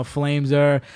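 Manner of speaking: usual speaking voice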